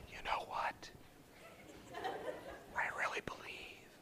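hushed, earnest whisper with pauses. Retold or imitated. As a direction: whisper encouragement to someone agitated. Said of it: "I would like him to whisper to me".

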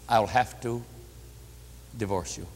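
low, hushed, with a heavy pause; quiet, halting retold announcement; reluctant ultimatum. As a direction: voice the husband's ultimatum, dropping very soft. Husband reluctantly announces divorce ultimatum.

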